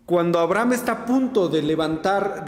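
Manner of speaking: normal talking voice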